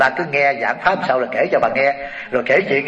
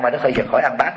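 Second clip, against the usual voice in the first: ordinary talk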